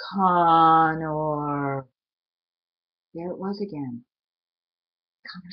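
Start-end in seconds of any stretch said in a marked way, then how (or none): 0.1-1.9 low, drawn-out monstrous call; monster calling. Acted. The monster calling Connor from the dark, drawn out. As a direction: call his name like something vast outside.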